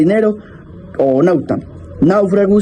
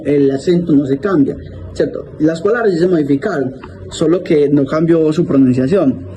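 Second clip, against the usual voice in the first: usual speaking voice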